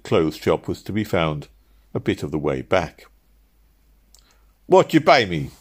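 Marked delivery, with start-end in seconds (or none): 4.7-5.5 high, loud cabman's plea; pleading. Acted. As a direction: plead for your fare, voice raised. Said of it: "he pleaded".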